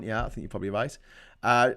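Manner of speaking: usual speaking voice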